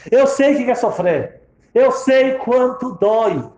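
high, loud, emphatic; impassioned insistence. Retold or imitated. Old woman who lost children to stranger, impassioned insistence. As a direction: press each statement home.

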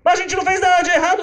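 put-on very high, loud kids' voices protesting; mock tearful kids facing the judge; crying children. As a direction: whine it like children begging the judge. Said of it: "Vem os filhos chorando e falam".

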